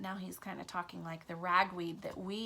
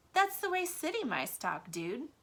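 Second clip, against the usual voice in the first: proud; 7 semitones higher than usual. A narrator's reading. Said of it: "he said proudly".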